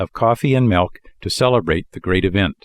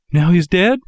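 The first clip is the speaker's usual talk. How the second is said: sorrowful interruption; 9 semitones higher, 4 dB louder than usual. A narrator's reading. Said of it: "Pinocchio interrupted sorrowfully".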